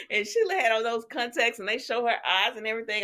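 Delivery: normal talking voice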